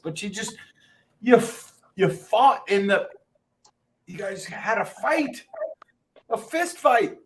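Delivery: high-pitched retold disbelief; baffled, voice jumping up; incredulous. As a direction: challenge them in acted astonishment. Acting out disbelief at villagers' fight.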